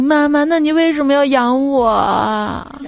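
put-on slow, teary little kid; crying child. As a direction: sob out the question. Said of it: "然后孩子就哭了". (孩子哭着慢慢问)

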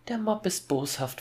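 very high put-on girl's voice. A narrator's reading. Child warning father about the mob, high girl's voice. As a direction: pitch it up like a little girl. Gretel voice returns in threat.

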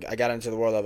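sudden loud burst; spike in voice. Real, not acted. Podcaster restarting a sentence, spike in voice. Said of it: "sorry, I had a spike in my voice".

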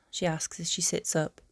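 usual speaking voice

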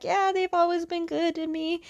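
high, lighter voice for the bride; polite acted reply. Bride answering about her lovely parents, politely, high.

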